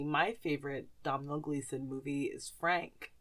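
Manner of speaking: usual speaking voice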